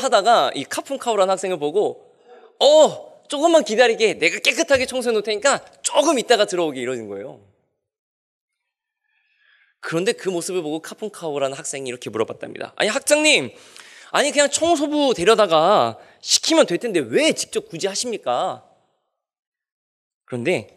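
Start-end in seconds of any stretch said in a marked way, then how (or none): 2.6-6.8 busy dean. Retold or imitated. Dean answers while cleaning. 12.8-18.6 puzzled student. Retold or imitated. Student questions the dean.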